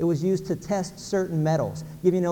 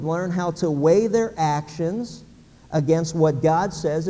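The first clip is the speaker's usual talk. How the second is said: slow emphatic summary; slower than usual. Real, not acted. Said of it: "summing up, slowed emphatic".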